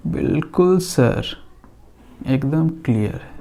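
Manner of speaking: teacher playing a student who says yes sir, soft and slow; softly and slowly, like a student agreeing a bit too eagerly; mock student reply. Teacher voices a class answering him, a soft slow mock reply.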